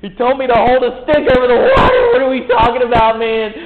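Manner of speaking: loud, high, frantic Moses; panicked outburst. Retold or imitated. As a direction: blurt it out in alarmed disbelief. Panicked Moses as speaker would.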